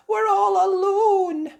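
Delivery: put-on a lost little girl; frightened Gretel. A narrator's reading. Gretel realising they're alone, high and scared. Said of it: "Gretel cried".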